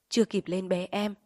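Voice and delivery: usual speaking voice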